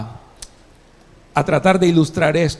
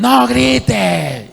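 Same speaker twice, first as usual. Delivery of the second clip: mock parent shouting no grite, higher; yelled command; 6 semitones higher than usual. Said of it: "porque a veces nosotros decimos". (padre gritando no grite)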